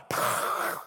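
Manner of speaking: exaggerated phlegm-clearing rasp; mock guttural pronunciation, all noise; phlegmy throat noise. Shown as a demonstration. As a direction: hawk up a joke Hebrew guttural. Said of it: "just put phlegm at the end".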